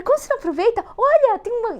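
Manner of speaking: put-on childlike excitement. Showing the man a whale, delighted. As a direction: point it out like a thrilled child. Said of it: "eu parecia uma criança".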